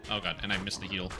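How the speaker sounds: ordinary talk, nothing marked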